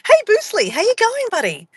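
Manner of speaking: saying hi to Boostly, high sing-song voice; cheery greeting. Real, not acted. Greeting someone named Boostly.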